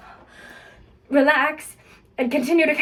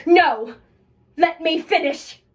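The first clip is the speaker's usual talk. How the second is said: angry outburst; 4 semitones higher, 4 dB louder than usual. Acted. Cuts Scott off loudly.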